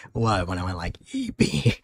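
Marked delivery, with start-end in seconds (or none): 1.1-1.8 very high squeaky cartoon pitch; squeaky Eevee voice. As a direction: squeak the name like a tiny Pokémon. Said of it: "That voice caught you so off guard".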